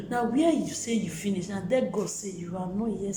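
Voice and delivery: loud encouraging exhortation; forceful preaching. Real, not acted. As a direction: push the hope hard at the listeners. Exhorts viewers not to despair.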